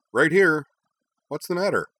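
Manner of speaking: small creature Nal. Acted. Nal perched on Korra, answering Hikaru in a high voice. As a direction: chirp it like a small winged creature.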